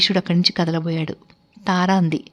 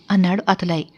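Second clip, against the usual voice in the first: plain reading voice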